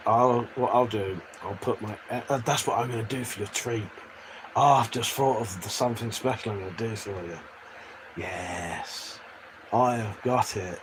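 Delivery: ordinary talk, nothing marked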